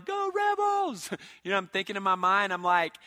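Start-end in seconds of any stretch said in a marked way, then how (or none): 0.0-1.1 high, drawn-out "go team" yell; stretched-out sports fan holler; yelled cheer. Retold or imitated. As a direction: cheer them on from the stands, loud and high. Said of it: "I'm yelling".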